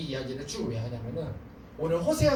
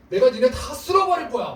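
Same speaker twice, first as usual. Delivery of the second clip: higher, louder threat; angry God; 6 semitones higher, 7 dB louder than usual. Retold or imitated. God warns his people he will sweep them away, loud angry threat. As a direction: thunder a warning at the people.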